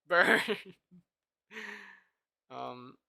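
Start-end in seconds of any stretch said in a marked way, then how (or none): 0.1-0.4 a loud laughing jab of Burn; laughing shout. Real, not acted. Streamer teasing a chatter, loud and laughing. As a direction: shout it through a laugh to tease.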